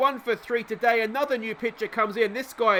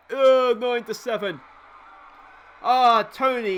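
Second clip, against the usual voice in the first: frustrated outburst; 3 semitones higher, 6 dB louder than usual. Real, not acted. Pitcher gives up home run.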